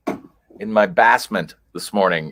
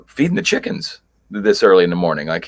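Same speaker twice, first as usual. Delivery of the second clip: ordinary delivery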